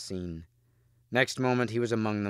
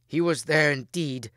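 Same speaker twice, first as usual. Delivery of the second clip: higher, louder urgent report; gasped; 6 semitones higher, 5 dB louder than usual. A narrator's reading. Wounded Mordred reporting to the king, lightly gasped. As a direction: push it out as a wounded man would. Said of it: "gasped Mordred".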